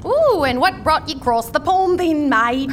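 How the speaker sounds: playful put-on Australian accent; mock Australian accent. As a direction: tease with an imitation Australian lilt. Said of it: "That's good, Buffy".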